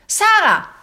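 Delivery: high loud call through the door; father calling. A narrator's reading. Father calling his daughter through the door, loud call. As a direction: call her name from outside. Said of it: "Era la voce di suo padre".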